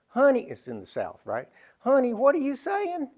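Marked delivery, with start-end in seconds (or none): 0.1-0.5 Southern head nurse. Retold or imitated. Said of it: "this head nurse looked at me and said". 1.8-3.1 Southern head nurse. Retold or imitated. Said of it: "this head nurse looked at me and said".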